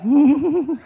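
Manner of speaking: high, quavering mock whimper; comic muffled high moan. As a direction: imitate a stifled lover's squeal. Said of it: "maybe you're the quiet type".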